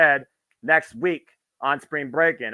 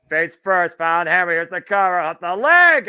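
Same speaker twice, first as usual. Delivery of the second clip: rising, louder live play-by-play; excited call; 5 semitones higher, 7 dB louder than usual. Real, not acted. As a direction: build the call up to the pin. Near-fall called live.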